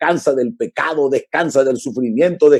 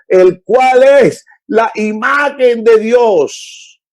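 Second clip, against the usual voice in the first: full-force preaching; 4 semitones higher, slower than usual. Real, not acted. Preacher proclaims the gospel to the congregation, slow and forceful. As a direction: proclaim it to the whole church.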